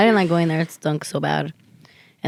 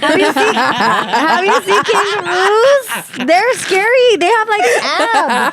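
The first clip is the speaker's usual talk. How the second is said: much higher and louder, slow, through laughter; laughing disbelief; 12 semitones higher, 5 dB louder, slower than usual. Real, not acted. Friend laughing at how buff kangaroos are, high laughing squeal. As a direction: squeal it while cracking up.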